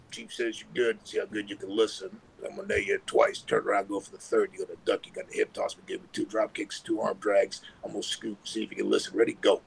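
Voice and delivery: low, rapid run of instructions; quick-fire wrestler calling a spot. Retold or imitated. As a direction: rattle off the moves low and quick. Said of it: "That's how fast he told me".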